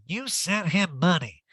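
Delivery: hammed-up impersonation; Erica. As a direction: caricature her disbelief with swooping pitch. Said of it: "And Erica's like".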